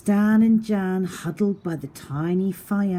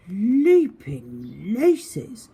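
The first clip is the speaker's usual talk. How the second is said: louder and slow with wonder; amazed cobbler at the new shoes; marvelling; 3 semitones higher, 7 dB louder, much slower than usual. Acted. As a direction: gasp at the magical shoes. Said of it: "marvelled Stan".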